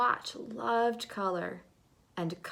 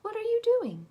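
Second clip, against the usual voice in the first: much higher and louder question; curious color voice; 14 semitones higher, 7 dB louder, faster than usual. Acted. Yellow color questioning Swatch, much higher and louder. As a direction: pipe up, surprised and curious. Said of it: "said yellowest yellow".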